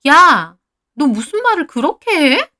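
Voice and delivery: high, loud indignation; offended protest. A narrator's reading. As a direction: snap back at an accusation. Friend protests in acted quarrel.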